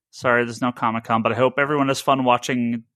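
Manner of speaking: ordinary talk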